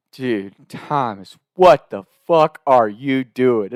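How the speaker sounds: slow, drawn-out exasperation; imitating exasperated brother. Brother after two missed shots, exasperated. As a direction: space it out in weary disbelief.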